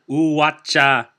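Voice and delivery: Uhuacha- drawn out slowly, sound by sound; a learner's slow, careful Uhuacha-; slowed-down pronunciation. Shown as a demonstration. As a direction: stretch each vowel of Uhuacha- to practise it. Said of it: "Let me slow that down".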